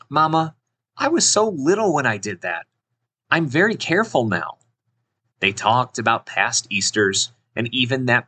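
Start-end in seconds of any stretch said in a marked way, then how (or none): 0.1-4.6 put-on higher-pitched child character; lifted voice of a girl defending herself; earnest daughter. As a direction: gently protest to mama in a little girl's voice. Girl answers her mother.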